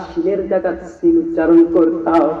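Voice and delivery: normal talking voice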